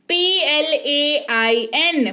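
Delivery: slow, letter by letter spelling; each letter of पी एल ए आई एन said slowly and separately. Shown as a demonstration. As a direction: spell it out like on a board. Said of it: "spelling the word out".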